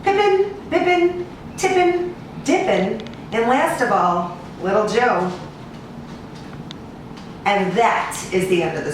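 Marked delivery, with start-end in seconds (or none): none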